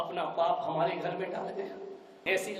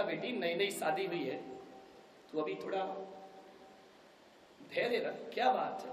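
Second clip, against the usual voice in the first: gentle father; 9 dB quieter than usual. Acted. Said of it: "लड़की के पिता ने कहा".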